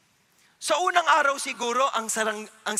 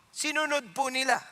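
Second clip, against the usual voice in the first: normal talking voice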